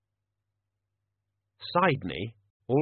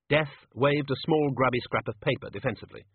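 plain reading voice

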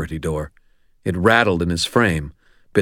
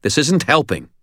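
tight voice; 9 semitones higher than usual. A narrator's reading. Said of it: "I said, my voice tight".

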